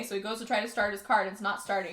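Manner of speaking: normal talking voice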